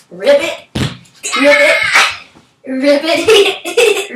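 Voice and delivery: loud, high ribbit; frog ribbit. Shown as a demonstration. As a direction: croak it out like a happy frog. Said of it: "Can you ribbit like a frog".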